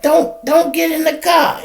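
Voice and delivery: high, hollering cop voice. Retold or imitated. Cop hollering at the woman as she gets in, high holler. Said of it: "He hollering at her".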